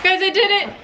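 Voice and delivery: high, winded victory cry; triumphant. Real, not acted. Vlogger telling viewers the machine made it up, triumphant. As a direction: announce it proudly, pitched well up.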